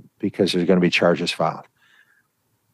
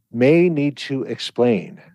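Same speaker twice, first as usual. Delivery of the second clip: ordinary talk, nothing marked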